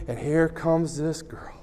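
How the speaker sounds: conspiratorial whisper; hushed whisper. Retold or imitated. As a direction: whisper it like a secret. Whispered aside in story.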